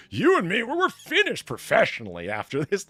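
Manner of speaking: high, loud mock tirade; fly off the handle, imitating a furious actor. Imitating an actor berating the lighting guy, mock-furious rant. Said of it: "flying off the handle at this guy".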